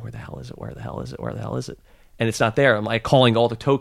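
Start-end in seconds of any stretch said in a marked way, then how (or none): 0.0-1.8 much softer, repeated under the breath; muttered search. Retold or imitated. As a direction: mutter it to yourself while searching. Retold frantic search for truck.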